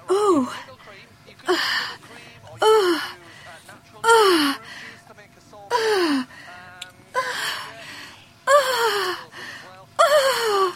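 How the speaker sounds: faked high panting groans; fake groaning. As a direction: groan as if making love for show. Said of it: "Make a groaning noise".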